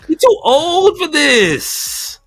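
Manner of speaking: normal talking voice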